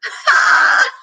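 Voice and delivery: loud high burst of laughter; old woman laughing at the girl's nickname. A narrator's reading. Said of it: "Gloria Dump laughed".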